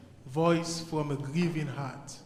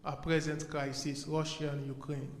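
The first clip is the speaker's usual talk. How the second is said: ordinary delivery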